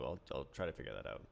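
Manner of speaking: hoarse, cracking sick voice; a sick, worn-out voice. Real, not acted. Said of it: "my sick voice".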